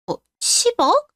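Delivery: high, slow, stunned 'ten billion?'; shocked disbelief. Acted. Sister hearing her brother's debt, stunned high disbelief. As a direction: let the number knock the breath out of you.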